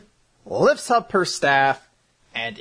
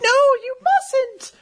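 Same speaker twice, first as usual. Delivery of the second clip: very high, drawn-out falsetto plea; hammed-up falsetto cry of alarm; pleading girl; 4 dB louder, slower than usual. Mavis running to stop Makarov, high mock pleading.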